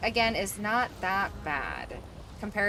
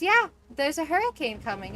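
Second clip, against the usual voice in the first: high, loud imitation of the caller; emphatic retold news; 8 semitones higher, 6 dB louder than usual. Caller breaks hurricane news to agent, emphatic imitation. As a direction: announce it big, pitched up.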